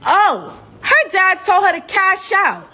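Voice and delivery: high, hammed-up moment of outrage; indignant realization. Captive realizing friend's dad wants the reward, indignant. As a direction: pitch it up as it dawns on you.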